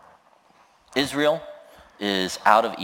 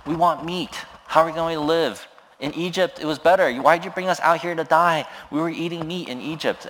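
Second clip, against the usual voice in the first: higher, whining complaint about missing meat; whiny complaining crowd; 7 semitones higher, faster than usual. Retold or imitated. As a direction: moan like hungry ungrateful people. Israel complaining to Moses.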